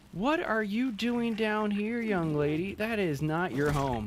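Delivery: high, drawn-out telling-off that slides down; mock scolding. Gamer to a stray ant in the wrong place, mock scolding.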